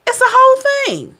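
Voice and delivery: high sing-song. Acted. To the viewer, a high sing-song voice. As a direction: lift it up high and let it fall.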